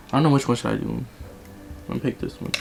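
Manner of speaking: very soft, barely voiced aside; muttering to self. Real, not acted. Vlogger choosing a lip colour, muttering to self. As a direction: think aloud under your breath.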